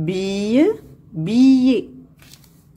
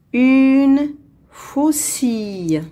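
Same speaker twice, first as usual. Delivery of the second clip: higher, slow and drawn-out dictation; slow model reading; 4 semitones higher, slower than usual. Shown as a demonstration. Teacher reading for pupils to hear the sound, slowly. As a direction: stretch each word out clearly. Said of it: "On va lire".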